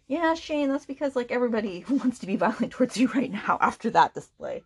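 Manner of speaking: high, mocking, with a laugh; sarcastic retort. Reviewer mocking Shane after his cruel scene, laughing sarcastically. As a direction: talk back to the character, amused and scornful. Reviewer mocks character aloud.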